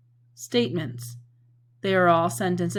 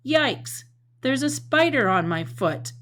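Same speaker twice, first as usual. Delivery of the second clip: high, louder startled cry about a spider; a hammed-up fright, pitched high; scared exclamation; 6 semitones higher, 3 dB louder than usual. Shown as a demonstration. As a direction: yelp it like a spider just landed on you. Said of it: "saying that with lots of expression and lots of feeling".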